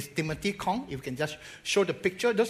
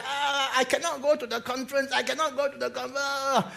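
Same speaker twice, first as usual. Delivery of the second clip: much higher, whining mock sobbing; imitated crying plea; 9 semitones higher than usual. Pastor imitates the patient crying about missing the conference. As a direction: whine it like a crying old man. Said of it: "He said".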